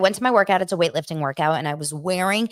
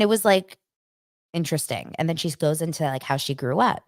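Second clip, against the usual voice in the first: ordinary talk, nothing marked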